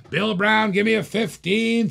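calling out bid. Real, not acted.

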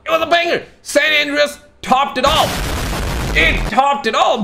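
high-pitched, emphatic fan praise; excited hype. Real, not acted. Longtime fan praising the old games to viewers, in high excitement. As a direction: proclaim it high with rising enthusiasm.

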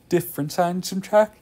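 talking through a yawn; words stretched by a yawn. Real, not acted. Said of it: "Sorry, I'm yawning".

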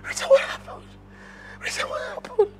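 despairing whisper. Acted. Husband despairs over wife.

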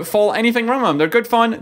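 ordinary talk

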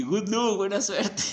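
loud, high, laughing "good luck, buena suerte". Acted. Teasing the new servant about serving the guru, laughing. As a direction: wish him luck while cracking up. Mocking send-off to fellow devotee.

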